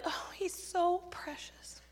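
high, soft, drawn-out coo; mock adults gushing softly over a cute child; patronizing coo. Adults reacting to a child's calling, patronizing coo. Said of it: "What adults would do, we'd be like".

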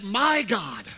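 weight on "My" in "My God"; personal address. Retold or imitated. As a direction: claim God as your own by leaning on "My". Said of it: "He's like".

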